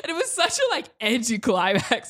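high burst of laughter into words; laughing. Real, not acted. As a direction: laugh through the start of the line. Laughing at anticlimax.

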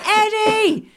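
loud, high call across a room; mock cheerful yell of a name; shouting greeting. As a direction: call out his name with delight. People shouting greetings at him.

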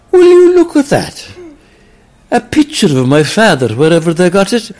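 sudden high amazed cry. Acted. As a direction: gasp in wonder at the mirror. Character amazed at mirror.

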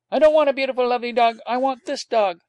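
put-on high, loud child's insistence; a small boy putting his foot down; determined small boy. A narrator's reading. Small boy repeating to his nurse, high and determined.

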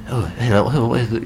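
mock Scottish, through a pursed mouth; tight-mouthed Scottish impression. Co-host teasing the host's accent, tight-mouthed mock Scottish. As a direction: imitate a tight-lipped Scottish accent, softly. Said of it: "very tight-mouthed, wasn't it?".

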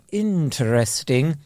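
slow, drawn-out posh INTERESTING; mock posh sneer; posh disdain. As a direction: stretch it with veiled upper-class disapproval. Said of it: "That's the difference between posh people".

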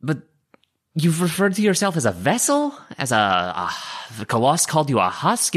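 normal talking voice

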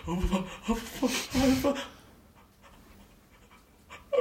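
high startled oh-oh-oh; whimpering little scared gasps; frightened squeak. Acted. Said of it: "he just kinda goes".

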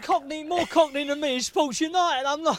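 mock banter. Jokey banter about Cockney roots.

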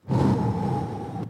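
long airy exhale; slow mimed smoker's puff; blowing smoke. Retold or imitated. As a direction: blow imaginary cigarette smoke at someone. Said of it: "blowing smoke all over me".

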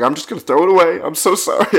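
high-pitched, pleading apology; flustered apology. Retold or imitated. Panicked apology to security officer.

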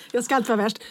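high laugh then joking line. Real, not acted. Host laughing at own exaggerated bottom. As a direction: laugh at your own joke.

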